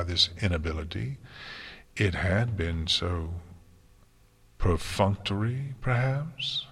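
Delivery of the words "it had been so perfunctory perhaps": plain reading voice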